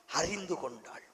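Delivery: ordinary talk, nothing marked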